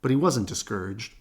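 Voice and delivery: ordinary delivery, nothing marked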